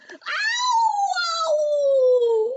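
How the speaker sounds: very high, loud pained scream. A narrator's reading. Wolf landing in boiling water, screaming. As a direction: howl in pain. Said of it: "screamed the wolf".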